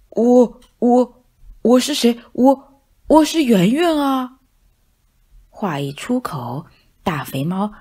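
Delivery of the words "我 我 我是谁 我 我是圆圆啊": loud stammering fat cat, caught off guard; flustered big cat. Acted. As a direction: stumble over your own name, startled. Startled cat stammers his name. (大肥猫吓了一跳，结结巴巴地大声说)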